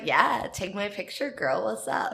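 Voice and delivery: soft, hushed flirty line with a laugh; mock flirty. Flirting with the hot sister.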